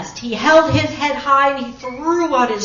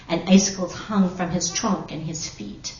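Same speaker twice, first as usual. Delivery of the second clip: ordinary delivery, nothing marked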